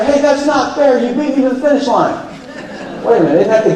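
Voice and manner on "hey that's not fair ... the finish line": high, hammed-up complaint of a sore loser; mock whiny loser. Speaker mocking a racer who lost the race. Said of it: "Jump out of the car and say".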